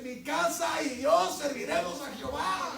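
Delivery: loud, high proclaimed verse; preacher shouting the verse to the congregation; shouted verse. Acted. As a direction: shout the Scripture like a banner. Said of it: "la Biblia dice".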